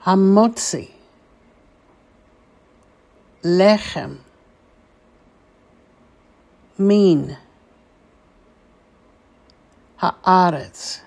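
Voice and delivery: one word at a time with long pauses; teacher's slow word-by-word model for repeating; slowly, word by word. Shown as a demonstration. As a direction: say each word carefully, leaving room to repeat. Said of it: "say these four words slowly".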